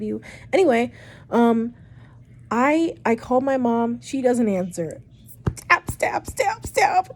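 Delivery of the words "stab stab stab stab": high, odd voiced stab stab; sharp high mock sound effect; mock stabbing noise. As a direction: mimic a dial tone as stabs. Imitating phone ringing unanswered.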